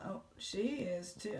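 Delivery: normal talking voice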